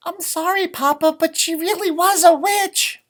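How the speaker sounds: high, pleading small-chick voice; little chicken voice. A narrator's reading. Chicken apologizes to Papa, high little voice.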